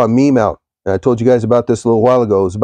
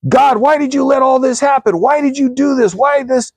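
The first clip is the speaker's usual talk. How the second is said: much higher, pleading wail to God; desperate cry to God; 12 semitones higher than usual. Retold or imitated. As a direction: throw up his hands and beg heaven why. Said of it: "and he finally goes".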